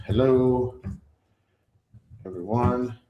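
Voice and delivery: drawn-out words with long pauses; typing aloud. Real, not acted. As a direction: say it slowly as you type it. Reads out what he types.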